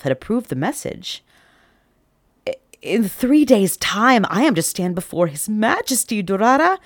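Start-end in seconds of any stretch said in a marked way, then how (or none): 2.5-6.8 stammering, awed and scared; mild nervous wonder; fear and wonder. Acted. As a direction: tell the cat in disbelief. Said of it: "a mix of fear and wonder".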